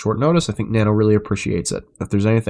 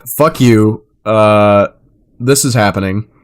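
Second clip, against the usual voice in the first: loud, slow breezy rudeness; mock casual kiss-off; 8 dB louder, much slower than usual. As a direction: toss off the insult casually. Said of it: "that's basically the vibes I'm getting".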